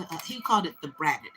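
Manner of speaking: ordinary talk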